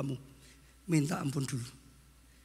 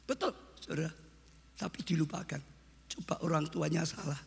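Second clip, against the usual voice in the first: ordinary talk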